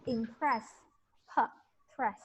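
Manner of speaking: "impress" with the p picked out by itself; teacher breaks down "impress" at the p; pronunciation model. Shown as a demonstration. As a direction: model "impress", then isolate p and "press". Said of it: "we pronounce it impress".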